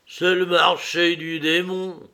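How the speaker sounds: high, loud, slow croak; hoarse hiccupping. A narrator's reading. Woman jeering after the departing man, hoarse and hiccupping. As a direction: croak it out raucously, drawn out. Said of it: "hoqueta-t-elle d'une voix éraillée".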